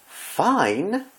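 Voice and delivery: high, incredulous echo; Rarity aghast at her friend's calm; dramatic echo. Acted. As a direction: toss the word back in theatrical disbelief. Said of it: "Rarity echoed dramatically".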